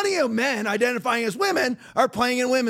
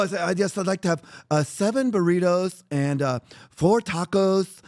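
mock feminine voice; 5 semitones lower than usual. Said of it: "you can talk like that all you want".

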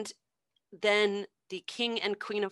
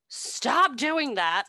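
higher, slow bossy queen; a slow, higher royal scolding; slow mock queen command; 5 semitones higher, 4 dB louder, much slower than usual. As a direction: drag it out like a cross queen. Queen voiced in retold story.

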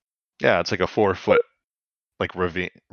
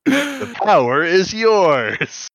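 higher, louder, very slow pronouncement; slow solemn catchphrase; 9 semitones higher, 4 dB louder, much slower than usual. Retold or imitated. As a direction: intone it like a hero cartoon. Quoting cartoon catchphrase.

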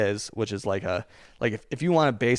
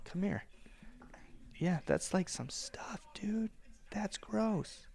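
quiet; 9 semitones higher, 15 dB quieter, slower than usual. Real, not acted. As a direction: keep it soft and close. Wiping toddler's nose up close.

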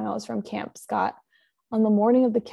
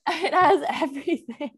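laughing and higher; 6 semitones higher, 3 dB louder than usual. Real, not acted. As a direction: giggle it out. Laughing about the story.

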